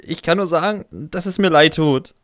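tearful, pleading apology; close to tears. Acted. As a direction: stammer out an apology, about to cry. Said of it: "stammle ich, den Tränen nahe".

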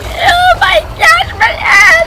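mock cry. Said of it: "And then I was like".

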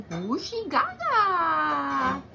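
put-on slow, drawn-out baby talk; baby babble. Baby William answering his sister, slow babble. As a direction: gurgle it like an infant. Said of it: "He's a baby".

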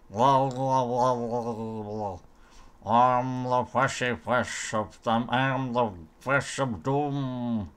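burbling put-on fish-creature voice; slow, drawn-out mock monster chant; fish of doom. Player in water armour playing the fish of doom, burbling creature voice.

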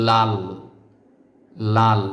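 লাল given as the model answer; clear, firm লাল for students to copy; correct pronunciation. Shown as a demonstration. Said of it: "উচ্চারণ হবে". (সঠিক উচ্চারণে লাল)

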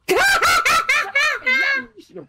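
a long high laugh; real laughter at the joke. Real, not acted. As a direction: burst out laughing. Laughing at a teammate.

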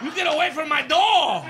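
high mock-accented yelling dog voice; dog as Chinese barker. Dog yelling at the mailman at the door, high mock accent. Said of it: "like, a Chinese bark".

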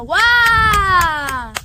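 loud excited whoo; cheering shout. Acted. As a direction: cheer like a crowd. Cheering after announcement.

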